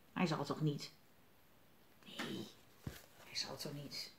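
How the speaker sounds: low, very soft wondering; hushed suspense. A narrator's reading. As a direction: murmur it as if hardly daring to guess. (zacht en laag, vol spanning)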